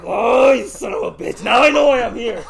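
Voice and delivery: loud, slow, laughing outburst; laughing shout. Retold or imitated. Realizing the kid snitched, loud laughing outburst. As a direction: blurt it out loud through laughter.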